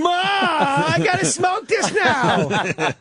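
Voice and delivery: put-on high, slow yelling stoner kid; stoner yelling to mom. A forty-year-old stoner shouting to his mom, high slow yell. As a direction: holler it to Ma upstairs.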